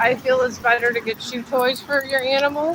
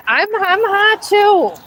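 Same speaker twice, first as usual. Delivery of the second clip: much higher, slow, louder whine; whiny complaint; 9 semitones higher, 5 dB louder, slower than usual. Real, not acted. Owner whining to her dog that she is hot too, high and drawn out.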